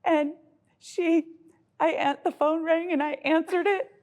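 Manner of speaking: high, halting mock-sobbing confession; imitated tearful child. Mother mimics her daughter's confession, high and mock-tearful. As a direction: imitate a child confessing through tears, in bursts. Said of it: "just started crying one day".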